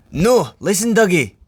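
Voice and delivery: insistent, high Scottish twin; Donald. A narrator's reading. Donald to Douglas at the drift, insistent. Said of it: "insisted Donald".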